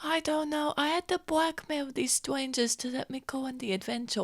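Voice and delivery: slightly high, soft character voice; secretive young adventurer. Acted. Adventurer admitting blackmail to a child, hushed. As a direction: confide it in a hushed voice.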